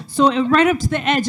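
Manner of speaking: ordinary talk, nothing marked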